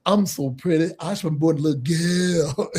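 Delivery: preen it like a flamboyant star; Dusty's version. Retold or imitated. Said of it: "do it like this".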